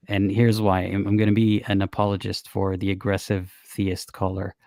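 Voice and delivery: ordinary talk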